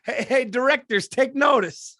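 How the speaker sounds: hammed-up call to directors; mock self-promotion. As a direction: pretend to pitch yourself to casting. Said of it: "jokingly auditions for directors".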